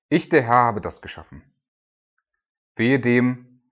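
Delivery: solemn, weighty Bible reading; declaiming scripture. Acted. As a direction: read it like a prophet proclaiming. Reading scripture aloud (Isaiah 45).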